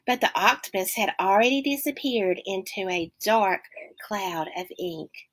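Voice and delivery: plain reading voice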